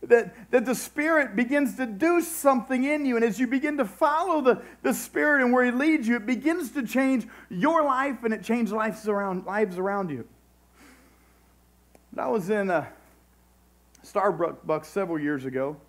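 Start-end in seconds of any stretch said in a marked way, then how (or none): 0.0-10.3 raised voice of a preacher driving the promise home; impassioned preaching. Real, not acted. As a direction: preach it with rising urgency, leaning on key words. Preaches with raised, stretched phrases.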